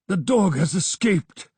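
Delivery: out of breath, voice pushed high and loud; panting. A narrator's reading. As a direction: gasp the words out as if you ran here. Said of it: "he panted".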